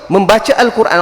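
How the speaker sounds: ordinary talk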